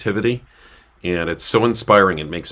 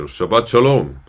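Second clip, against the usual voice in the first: put-on loud, high greeting in a thick Israeli accent; 5 semitones higher, 7 dB louder than usual. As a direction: call it out with a heavy Israeli accent. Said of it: "in this thick Israeli accent".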